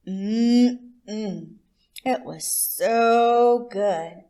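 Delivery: slow, loud, drawn-out mmm of pleasure; savoring. Acted. Narrator savoring the stolen butter, slow and relishing. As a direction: relish every word like a mouthful of butter. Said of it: "savoring the butter".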